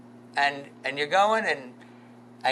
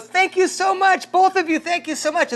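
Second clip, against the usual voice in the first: put-on much higher, gushing young woman's thanks; grateful girl; 12 semitones higher than usual. Preacher quoting the stranded girl's thanks, high gushing voice. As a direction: gush thanks like a relieved girl. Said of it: "the girl goes".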